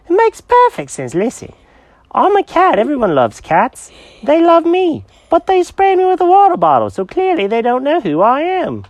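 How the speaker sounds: high, eager cat character; excited cat voice. Acted. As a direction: bounce it out like an excited kitty. Jenkins voice.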